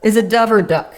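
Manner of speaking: high, loud, slow questioning; emphatic clarifying. Retold or imitated. Pressing the Cajun hunter for the word, emphatic. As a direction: spell out each choice for him. Acted struggle to understand him.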